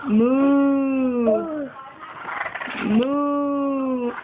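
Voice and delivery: long, drawn-out pretend moo; cow moo. Quizzing toddler on animal sounds, long mooing. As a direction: hold the vowel like a cow. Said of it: "What makes that sound".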